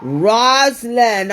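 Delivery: long, loud, high call of the name; drawn-out call. Acted. Psychic calling to Rosalind, slow and loud. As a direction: stretch the name out like a revelation.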